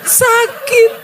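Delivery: very high, loud pained laugh; very high, loud pained laugh-cry. Real, not acted. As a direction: let the hurt break out through a laugh. Painful admission, laugh-cry.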